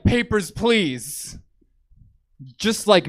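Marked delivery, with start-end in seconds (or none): 0.0-1.4 slow, high, drawn-out official demand; panini guy. Acted. Panini guy demanding papers from a customer, slow mock-official demand. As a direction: play an officious guard asking for documents. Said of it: "the guy was like".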